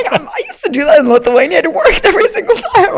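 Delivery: laughing through it, high and loud. Real, not acted. Telling friend about plagiarism, laughing. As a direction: confess it while laughing.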